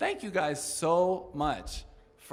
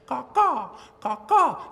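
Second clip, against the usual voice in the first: high, loud crow calls falling off; crow caws; 9 semitones higher, 7 dB louder than usual. Pretend. Crow calling to her friends, high caws.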